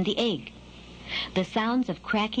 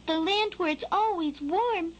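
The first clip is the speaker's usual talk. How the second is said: high, puzzled chick voice; small bird piping up with questions; baby bird; 9 semitones higher than usual. A narrator's reading. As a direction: ask high like a curious hatchling. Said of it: "asked Skylark".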